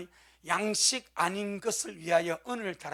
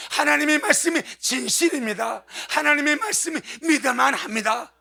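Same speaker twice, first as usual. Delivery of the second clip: loud, higher-pitched repeated proclamations; forceful preaching; 6 semitones higher, 10 dB louder than usual. Real, not acted. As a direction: drive each declaration home from the pulpit. Preaches in rising declarations.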